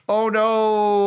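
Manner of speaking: high, loud mock panic; a sudden hammed-up oh no. Acting the guy stuck.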